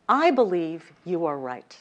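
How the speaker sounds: I stressed, rest falls. Shown as a demonstration. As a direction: hit the word I first. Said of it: "move the emphasis along the sentence".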